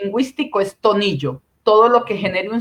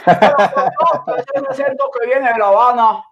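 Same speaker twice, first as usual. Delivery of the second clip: laughing through the words, a bit higher; 4 semitones higher than usual. Real, not acted. Colleague teased about her Cuban accent, laughing. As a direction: laugh it out while answering.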